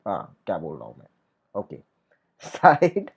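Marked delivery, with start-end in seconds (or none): none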